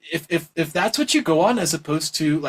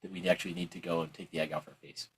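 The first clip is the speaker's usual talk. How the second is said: mock dismissive mumble; 8 semitones lower, 9 dB quieter than usual. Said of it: "they'll just be like".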